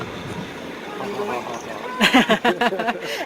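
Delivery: villainous laugh, hammed up; evil cackle. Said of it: "Essa foi a risada maligna do [name]".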